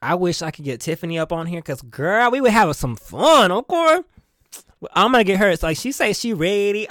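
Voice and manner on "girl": ordinary talk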